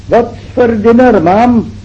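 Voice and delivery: high, loud cheeky call from above; shouted down. Retold or imitated. Even on the roof shouting down to his wife, loud cheeky call. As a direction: holler it down carelessly. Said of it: "what do you think he shouted down".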